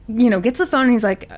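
usual speaking voice

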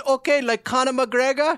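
high, loud mock Middle Eastern scammer; angry Middle Eastern accent. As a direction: taunt them in a put-on Middle Eastern accent. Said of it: "angry Middle Eastern guys".

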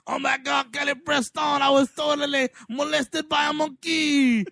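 a cheesy fake French accent that breaks into a laughing shout; shitty French accent. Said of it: "That's a really shitty French accent".

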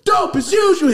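high, loud hype call of the catchphrase; hype shout. Acted. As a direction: yell it like a stage entrance.

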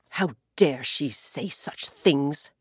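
the sky, stung and indignant; offended cry. Acted. Sky outraged at the ocean's supposed insults, slow and low. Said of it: "he cried".

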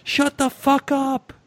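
high, slow mock yell of a swear; retold yell. Reading out the letter writer yelling at the heckler, high mock yell. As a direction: bark it at the heckler, hammed up. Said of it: "I yell towards the voice".

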